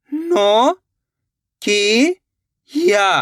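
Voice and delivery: "नो ... की ... या", long stretched parts with pauses; syllable by syllable. Shown as a demonstration. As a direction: sound out "नो की या" slowly and separately. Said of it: "मिलके बन जाएगा Nokia". ("नो ... की ... या" रुक-रुककर, खींचकर)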